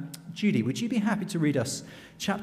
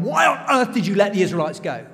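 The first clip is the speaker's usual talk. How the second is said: loud, higher angry demand; furious yelling; 4 semitones higher, 6 dB louder than usual. Acted. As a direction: yell it at everyone like an enraged king. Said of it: "he is yelling at everyone".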